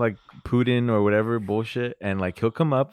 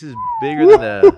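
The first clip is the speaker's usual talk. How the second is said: excited; 12 dB louder than usual. Real, not acted. Reacting to the beer's strength.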